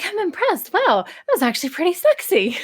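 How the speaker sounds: high, hammed-up pat on the back; mock self-praise. Writer praising her own finished script, high and hammed-up. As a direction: gush over your own work, tongue in cheek.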